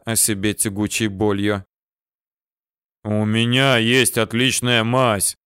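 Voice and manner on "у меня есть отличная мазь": медленный громкий орочий голос; бодро, неторопливо, как добродушный орк; голос орка. A narrator's reading. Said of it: "Голос орка". (голос орка, чуть громче)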